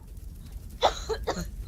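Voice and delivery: rough smoky coughing fit; coughing in the burning hall; choking cough. Acted. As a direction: choke on the smoke. Choking smoke.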